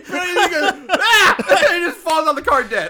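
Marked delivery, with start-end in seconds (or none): 0.9-1.4 high, loud comic yell; hammed-up dying scream; mock death cry. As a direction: yell out a silly sudden death. Imitating the death cry once more.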